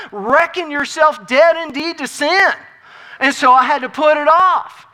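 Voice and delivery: loud, driving sermon delivery; emphatic pulpit proclamation; forceful preaching. Real, not acted. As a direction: preach it with rising force and long-held key words. Preaches dying to self.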